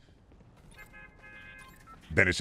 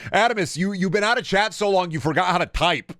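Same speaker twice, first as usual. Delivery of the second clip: mock scolding shout; 10 semitones higher, 4 dB louder than usual. Teasing a chatter loudly.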